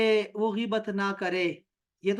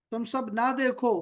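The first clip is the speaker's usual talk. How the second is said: ordinary talk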